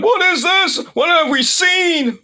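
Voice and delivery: high-pitched mock commentator cry; panicked announcers imitation. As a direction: imitate announcers losing it, last word stretched out. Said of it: "the announcers are going like".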